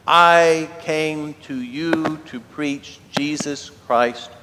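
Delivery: very slow, weighted preacher's delivery; emphatic declaration. Acted. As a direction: set each word down slowly, starting loud. Paul's solemn point after the grandeur.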